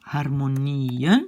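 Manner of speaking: "harmonieën" slow, long low NIE rising into -en; accent sur le I. Shown as a demonstration. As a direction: hold the NIE of "harmonieën", lift at the end. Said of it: "l'accent est sur le I". (« harmonieën » lent, NIE allongé)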